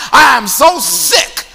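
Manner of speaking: loud rallying preaching; rally the church against corona; roaring rallying speech. Real, not acted. Preacher rallies the congregation.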